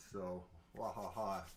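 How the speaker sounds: a quick Halloween villain laugh; evil laugh. Pretend.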